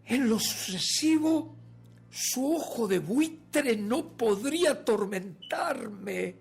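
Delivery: loud, high, drawn-out declaration; exultant, ringing dramatic narration; fierce triumph. A narrator's reading. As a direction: proclaim victory, stretching the final word. Killer triumphs over the dead eye.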